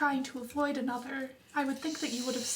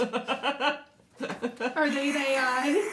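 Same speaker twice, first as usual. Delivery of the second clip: robot voice; 3 dB louder, slower than usual. Acted. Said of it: "You do sound like a robot".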